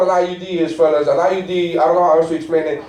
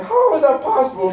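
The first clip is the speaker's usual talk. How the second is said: high, disbelieving question; incredulous; 9 semitones higher than usual. Retold or imitated. Husband to wife over pregnancy test prices, high incredulous. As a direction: act stunned by the price. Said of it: "I'm like".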